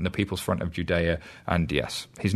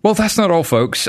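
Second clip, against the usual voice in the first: high, loud showman announcement; announcer voice; 10 semitones higher, 4 dB louder than usual. Acted. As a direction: boom it out like a game show host. Announcer segue to interview.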